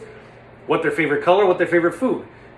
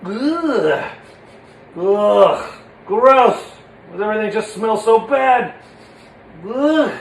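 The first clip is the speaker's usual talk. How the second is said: higher, louder grossed-out ugh; disgusted; 4 semitones higher, 4 dB louder, much slower than usual. Shown as a demonstration. Puppet sniffing something foul, disgusted and loud. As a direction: react like it stinks.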